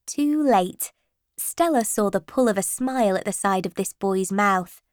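ordinary delivery

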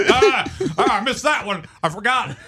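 high, goofy dog voice; dopey high-pitched dog; Chip dog voice. Acted. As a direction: blunder through it as a dim dog. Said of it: "That's Chip".

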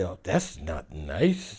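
low, slow, soft reproach; hushed tut-tutting objection; mock disapproval. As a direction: imitate a gentle scolding. People disapprove of his attitude.

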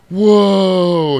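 loud, high, stretched gasp of wonder; amazed crowd. Retold or imitated. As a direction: marvel loudly at the flight. Crowd amazed at flying reindeer.

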